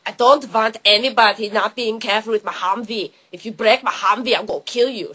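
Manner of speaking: mock Austrian tough guy; Austrian accent. Austrian dude warning about his Humvee, mock Austrian accent. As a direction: threaten in a thick Austrian accent. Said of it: "like, Austrian dude is like".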